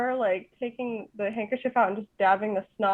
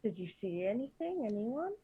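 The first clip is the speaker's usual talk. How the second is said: very soft, confidential voice; quiet, gentle questioning; hushed question; 13 dB quieter than usual. Acted. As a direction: ask the pony under your breath. Whispering to the pony.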